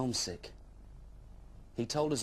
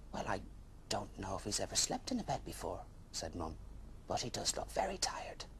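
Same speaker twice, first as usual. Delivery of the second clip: soft, hushed mother's voice; gentle mum; 3 semitones lower, 6 dB quieter than usual. Acted. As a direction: lower and softer, a tired kind mum. Mum answers softly.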